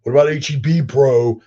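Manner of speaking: badly faked Texan, pushed high and loud; Texan guys complaining. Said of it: "all of my Texan guys would be going".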